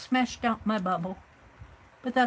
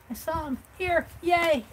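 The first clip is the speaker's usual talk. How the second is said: delighted find; 8 semitones higher, 4 dB louder than usual. Real, not acted. Finds lost page dividers.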